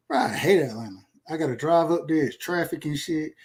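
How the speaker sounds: normal talking voice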